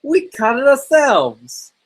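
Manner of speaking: put-on high, loud, drawn-out kid voice; bratty boy. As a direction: protest slowly in a high put-on boy's voice. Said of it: "Yes, that's the voice".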